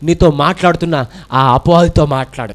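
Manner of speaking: normal talking voice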